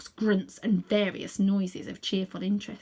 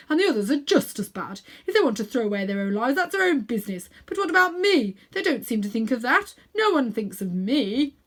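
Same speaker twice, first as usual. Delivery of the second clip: put-on aggrieved old uncle, louder and quicker, self-pitying; selfish old man grumbling; 3 dB louder than usual. A narrator's reading. Uncle Andrew rants to himself about the children, self-pitying. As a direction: whine like a petty, put-upon old man. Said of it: "he said to himself".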